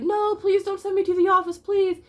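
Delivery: put-on high, pleading kid's sob; crying plea. Little boy begging the teacher not to send him, tearful. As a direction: beg through tears like a scared kid. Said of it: "Adam, was just crying".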